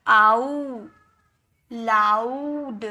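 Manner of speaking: आओ and लाउड with "ow" stretched slowly; drawn-out "ow". Shown as a demonstration. Teacher demonstrates the OU sound, लाउड stretched slowly as la-oo-d. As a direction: draw लाउड right out: la-ooo-d. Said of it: "यानी कि आओ में निकलेगा".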